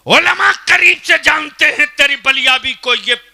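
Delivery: high, full-force shout; thundering self-defence. Real, not acted. Preacher saying the scholars know him well, thundering. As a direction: shout your standing to the crowd.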